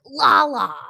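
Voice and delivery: high, loud mock holler; Sandoval. Sandoval venting right after, imitated high holler. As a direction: imitate a wall-smashing yell of the name. Acts out Sandoval yelling at wall.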